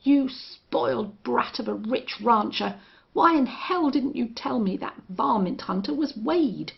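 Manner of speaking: loud, high furious cursing; cursing angrily. Acted. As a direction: rage at the spoiled brat. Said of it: "He cursed horribly".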